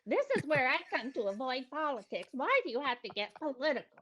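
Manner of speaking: mock the whining commenters; whiny complainer. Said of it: "all the people saying".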